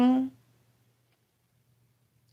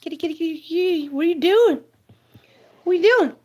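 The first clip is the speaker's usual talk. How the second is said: high cooing pet voice; 6 semitones higher, much faster than usual. Real, not acted. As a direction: coo at the kitty up high. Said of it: "I don't talk to her like that".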